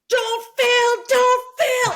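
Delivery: high, loud frantic yell; screaming at herself. Retold or imitated. Runner to herself near the finish, frantic scream. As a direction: yell it like a desperate self-command. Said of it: "screaming, talking to myself".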